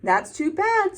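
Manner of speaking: squawky crow. A narrator's reading. Crow answering the grumpy buffalo in a high squawk. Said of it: "squawked the mangy old".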